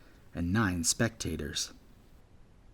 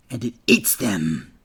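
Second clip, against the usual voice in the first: louder, lingering threat; hungry menace; 6 dB louder than usual. Acted. Bully Gale finishing the scary story to Jack, hungry menace. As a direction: savor the threat.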